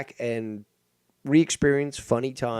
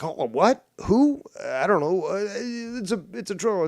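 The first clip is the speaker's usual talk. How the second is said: higher, louder flustered dodge, squeaking on Who; mocking Carlos; 9 semitones higher, 5 dB louder than usual. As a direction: play a caught-out streamer feigning ignorance. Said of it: "He's like".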